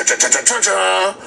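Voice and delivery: usual speaking voice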